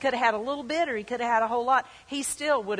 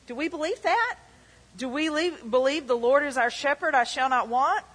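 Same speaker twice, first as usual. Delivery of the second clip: loud, high urgent preaching; a pastor rallying his church, loud and high; fiery preaching; 5 semitones higher, 5 dB louder than usual. Acted. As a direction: rouse the congregation with rising fervour. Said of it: "preaching at full force".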